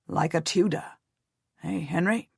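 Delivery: a proud uncle coaxing his nephew; uncle Jasper. A narrator's reading. Uncle voiced speaking to boy.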